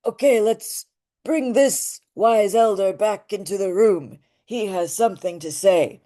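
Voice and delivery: dull-nasal wise elder character voice. Shown as a demonstration. Teacher brings the wise elder in to speak, wise elder voice. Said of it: "let's start with the wise elder".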